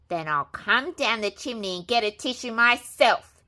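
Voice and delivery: wolf roaring up at the pigs. A narrator's reading. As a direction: bellow it in a temper. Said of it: "he roared".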